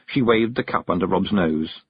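plain reading voice

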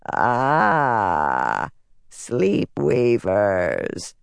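low, very drawn-out rasp; rasping dark figure. A narrator's reading. Dark figure recognising the devices, rasping. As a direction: rasp it slowly, savouring each word. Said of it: "a rasping voice said".

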